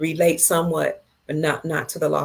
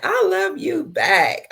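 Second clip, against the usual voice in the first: sing-song high; 13 semitones higher, 3 dB louder, slower than usual. Real, not acted. Answering a viewer's love.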